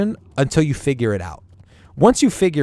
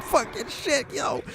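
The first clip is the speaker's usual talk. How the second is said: high, softer hammed-up bandit curse; a squeaky bandit swearing under fire; mock panicked bandit; 14 semitones higher, 5 dB quieter, slower than usual. Host mimicking bandits caught in a firefight, high and soft. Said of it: "They're like".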